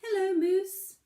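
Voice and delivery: high, loud little pebble voice. Acted. Pebble calls out to the moose, high eager voice. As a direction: pitch it way up as the small, eager pebble. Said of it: "the pebble said".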